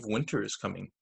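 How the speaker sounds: ordinary delivery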